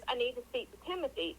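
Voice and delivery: normal talking voice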